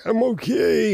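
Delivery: high, light imitation of a tough little girl; a hint of a kid bravely holding back tears. Uncle imitating his niece holding back tears, lightly voiced. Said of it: "she was just like".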